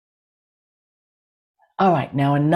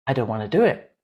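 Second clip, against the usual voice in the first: lower, stubborn refusal, lifting at the end; reluctant heart; 4 semitones lower, 4 dB quieter, much faster than usual. Acted. Heart answering the left brain, low and reluctant. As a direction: play the inner voice that won't budge. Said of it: "Your heart doesn't want to do it".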